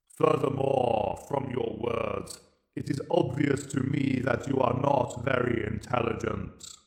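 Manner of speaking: slow, cold alien verdict; Dalek Emperor. Acted. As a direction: judge him like an unfeeling machine ruler. Emperor judges the captive.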